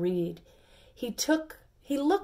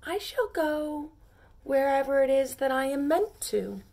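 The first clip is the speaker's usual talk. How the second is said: gentle small pea; 5 semitones higher than usual. Acted.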